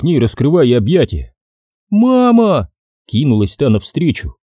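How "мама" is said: lightly voiced girl crying out; child calling. A narrator's reading. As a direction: call out to your mother with relief. Daughter runs to mother.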